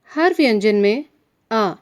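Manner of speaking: ordinary talk, nothing marked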